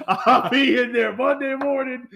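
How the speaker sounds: high, loud threat through laughter; loud, laughing callout; shouted joking threat. Real, not acted. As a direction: holler a warning, half laughing.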